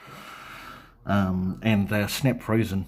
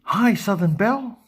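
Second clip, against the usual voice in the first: excited greeting; 11 semitones higher, 4 dB louder than usual. Real, not acted. Greeting a new live chat viewer.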